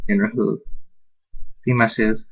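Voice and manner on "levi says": usual speaking voice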